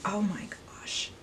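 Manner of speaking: thrilled breathy whisper. Real, not acted. To her viewers, an excited whisper. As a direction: whisper it, barely containing joy. Swatching palette.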